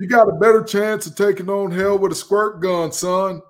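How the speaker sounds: loud, high barking gym coach; coach's voice. Acted. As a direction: bark it like a tough old coach. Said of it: "my coach's voice for this one".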